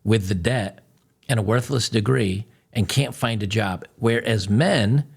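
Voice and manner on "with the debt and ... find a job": ordinary talk, nothing marked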